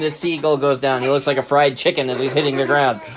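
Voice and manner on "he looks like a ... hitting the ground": ordinary delivery, nothing marked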